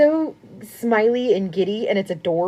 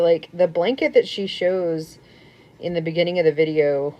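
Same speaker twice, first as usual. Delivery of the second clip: ordinary talk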